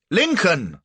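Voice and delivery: high, loud call of the name; shouting. A narrator's reading. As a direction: shout for Lincoln. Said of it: "I shouted".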